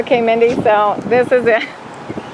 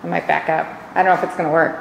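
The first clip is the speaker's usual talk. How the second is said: ordinary delivery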